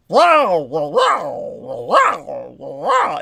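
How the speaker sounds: sing-song imitation of her speech; mocked Creole inflection. Imitating the way Juanita Taylor talked on the phone, wow repeated. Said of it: "it's like".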